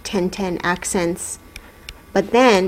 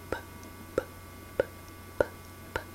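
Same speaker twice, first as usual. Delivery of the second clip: voiceless "P, p, p", just tiny puffs of air; whispered P sound; 18 dB quieter, much faster than usual. Shown as a demonstration. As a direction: whisper "p" as separate short pops, no voice. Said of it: "whisper it".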